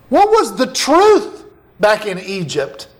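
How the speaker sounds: ordinary talk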